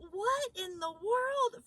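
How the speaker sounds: loud, high, incredulous exclamation; stunned disbelief at a thirty dollar tip; real astonishment, pushed up high and loud; amazed. Real, not acted. Astonished at homeless woman's tip.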